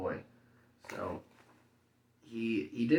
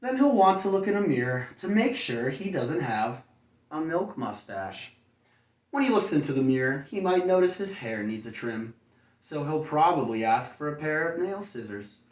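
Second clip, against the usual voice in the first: bright, lively storybook read, higher and louder; reading to children; 4 semitones higher, 5 dB louder than usual. A narrator's reading. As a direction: read it like bedtime story to little kids. Reads picture book to children.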